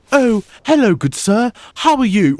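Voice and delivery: high, loud, mock-posh English greeting. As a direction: play the stuffy English gentleman. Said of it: "most people, when they think U K, it's like".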